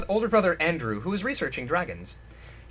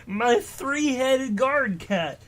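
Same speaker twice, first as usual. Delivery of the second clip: slow, higher giant voice, a touch worried; worried Shogrid; 7 semitones higher, 4 dB louder, slower than usual. Acted. Shogrid blurting a secret to Hunter, mildly worried. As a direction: let it slip, then regret it. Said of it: "he said worriedly".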